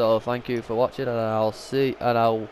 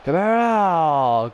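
urgent; 6 semitones higher, 5 dB louder than usual. Real, not acted. Urging goalkeeper save.

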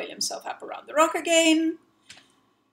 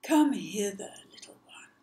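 luring crocodile whisper. Acted. Crocodile whispering to lure the child. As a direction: coax him closer.

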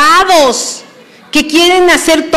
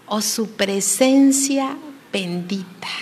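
reverent whisper; soft hushed whisper; 3 semitones lower, 7 dB quieter, slower than usual. Acted. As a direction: breathe it with reverence.

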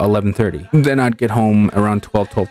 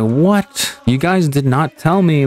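raised, upset complaint to the cable company; upset protest; 6 semitones higher, slower than usual. Retold or imitated. Cable company overcharges him, upset raised protest. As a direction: protest the surprise bill, voice pitched up high. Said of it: "I was just really upset".

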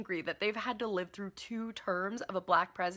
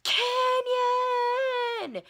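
much higher, loud shout; mock yell; 7 dB louder than usual. Trump yelling at Obama, a loud, high mock yell. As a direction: yell it out triumphantly. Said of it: "Donald Trump shouts and yells".

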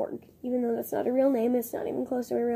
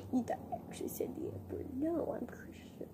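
hushed playful whisper; teasing whisper; 12 dB quieter than usual. Real, not acted. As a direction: whisper it conspiratorially. Whispered joke after fake swear.

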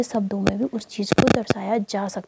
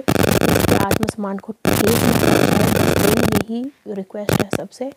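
emphatic plea; 5 dB louder than usual. Real, not acted. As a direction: plead firmly with commenters. Earnest plea to commenters.

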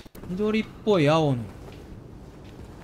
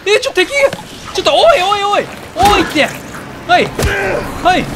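high, loud panic as an enemy rushes in; panicked yelling; 10 semitones higher, 7 dB louder, slower than usual. Real, not acted. Gamer suddenly attacked by an enemy, panicked high yelling. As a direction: yell it like you're being chased.

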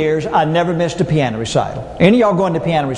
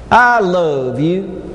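slow, lingering I love you; slow tender declaration; much slower than usual. Retold or imitated. Sweetheart phrase from dating days.

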